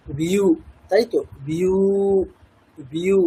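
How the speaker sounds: বিউ said as one glide, ব sliding into উ; repeated sound demo. Shown as a demonstration. Teacher modelling বিউ for Gita students, patient repetition. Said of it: "এরকম বললাম।".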